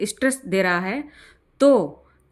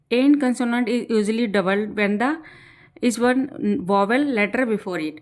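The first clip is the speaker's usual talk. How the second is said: slow dictation; much slower than usual. Shown as a demonstration. Teacher dictating grammar rule.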